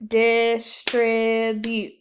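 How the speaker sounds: Distribute split into slow, loud syllables; clapped syllables. Shown as a demonstration. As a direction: clap Dis-tri-bute slowly. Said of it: "Clap it".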